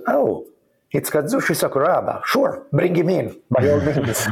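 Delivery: suddenly thrilled to let him in; playful delighted master voice; delighted welcome. Retold or imitated. Zen master welcoming him gladly.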